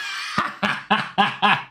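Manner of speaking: airy, breathless laughing fit; burst of laughter. Real, not acted. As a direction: crack up helplessly at the joke. Host cracks up at the clip.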